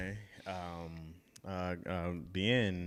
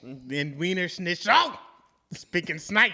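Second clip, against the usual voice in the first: higher, drawn-out mock-German bark; aggressive fake German; 4 semitones higher, 4 dB louder, slower than usual. Guest improvising German from movie villains, aggressive fake German accent. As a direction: punch nonsense out like a film-villain German. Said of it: "they don't talk that aggressive".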